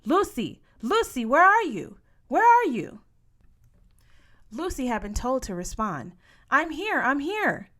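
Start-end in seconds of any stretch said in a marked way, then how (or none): 0.0-3.0 loud, high calling out, falling at each end. A narrator's reading. Healer calling loudly to the covered girl. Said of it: "she called out". 6.5-7.7 raised, high reply; calling back. A narrator's reading. Sister answering the healer's call, loudly.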